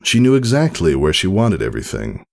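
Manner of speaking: ordinary delivery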